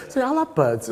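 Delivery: high and bright with glee; gleeful. Retold or imitated. Student gleefully realising the fashion photographer trick. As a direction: crow it out, delighted. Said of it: "It's like".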